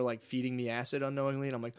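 normal talking voice

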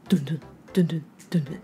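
quick rhythmic dun dun heartbeat sounds; imitated heartbeat. Shown as a demonstration. Teacher imitates a pulse for the class, quick dun dun. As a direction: tap out a pulse with the voice. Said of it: "这里的pulse是脉动的意思".

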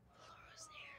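breathless whisper in fear; scared whisper. Real, not acted. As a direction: whisper it shaking with relief. Monster just passed by.

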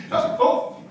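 breathy giggle. Acted. Toby giggling at his plot.

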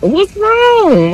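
high, squeaky "Dude, what's wrong?"; hammed-up high concern; worried mom. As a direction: ask in a high mock-concerned voice. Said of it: "I'm like".

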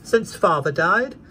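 put-on high, slow little girl voice; young girl. A narrator's reading. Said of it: "returned the child".

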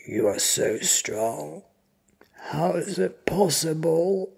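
loud, strained, gasping victim; breathless, frightened disbelief; struggling for breath. A narrator's reading. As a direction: force it out, short of air. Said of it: "He is struggling for breath".

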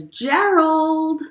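Piggy calling Gerald. Acted. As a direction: call out to your friend. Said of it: "Piggy says".

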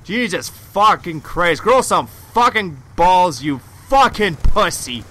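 raging gamer. Real, not acted. Gamer cursing an enemy.

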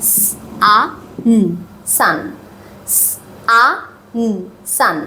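সান split into স, আ, ন then blended, twice; sounding out. Shown as a demonstration. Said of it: "কিভাবে লিখব".

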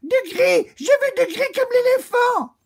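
put-on very high, loud childish demand; greedy demand. Lady Chameleon demands grey from the oak, very high and loud. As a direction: whine it like a spoiled child. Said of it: "s'écria".

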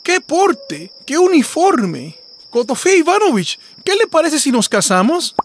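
high, admiring, coquettish voice; swooning over the grand cat; flirtatious fox. Acted. Fox courting the cat, admiring and flirty.

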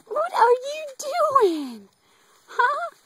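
high squeaky talk to a pet. Real, not acted. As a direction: coo way up high. Cooing at the pet.

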